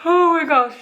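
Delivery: talking through a big yawn; words stretched by a yawn. Real, not acted. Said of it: "sorry, I'm yawning again".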